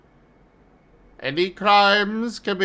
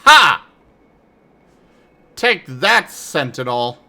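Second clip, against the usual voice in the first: triumphant shout; 3 semitones lower, 9 dB louder than usual. Real, not acted. Triumph over enemy.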